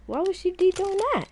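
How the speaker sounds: high-pitched, puzzled question; puzzled innocence. Acted. Deku asks why Momo would look at him, high and puzzled. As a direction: pitch it way up, clueless.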